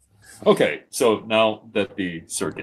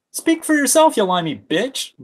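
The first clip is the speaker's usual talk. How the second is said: snorting retort; 6 dB louder than usual. Acted. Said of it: "Gaming snorted".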